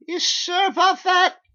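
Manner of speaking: very high, loud, slow doubtful voice; squeaky suspicious question. Acted. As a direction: drag out the doubt. Narrator doubting cashier.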